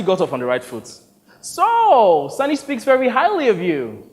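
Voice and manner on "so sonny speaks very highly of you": a higher, chatty American voice; director voice. Acted. Director making small talk with the actor, breezy and high. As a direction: open the chat breezily.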